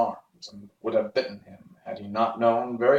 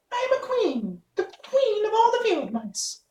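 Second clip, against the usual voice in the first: tiny, much higher regal squeak; proud mouse queen. Acted. Mouse queen proudly tells the Woodman who she is, high squeak. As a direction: announce your rank like a small proud queen.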